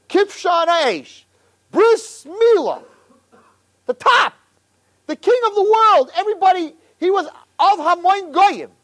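ordinary talk

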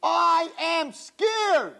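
I am scared, very slow, high; slow stressed admission. Shown as a demonstration. To the girls, a slow high admission. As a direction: drag out I am scared. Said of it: "Bitachon means".

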